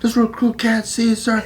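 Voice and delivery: high, mock-dutiful report; recruit answering. Recruit explains contacts to drill instructor, high mock report. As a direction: pitch up into a hammed-up recruit reply.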